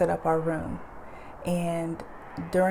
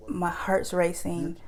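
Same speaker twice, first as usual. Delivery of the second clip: ordinary delivery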